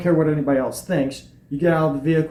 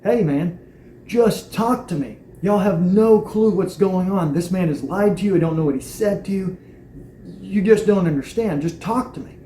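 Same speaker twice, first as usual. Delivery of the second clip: raised, pleading yell, slowed; 4 semitones higher than usual. Retold or imitated. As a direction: call out to them urgently. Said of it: "I'm yelling at 'em, going".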